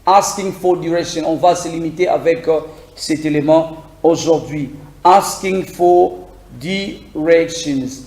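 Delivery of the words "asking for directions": slow title. Shown as a demonstration. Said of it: "quatrième élément".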